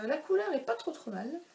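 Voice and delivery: ordinary talk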